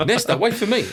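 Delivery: high, loud plea broken by laughter; laughing plea. Retold or imitated. Friend has run off, laughing high plea to wait. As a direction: laugh through a mock panicked call.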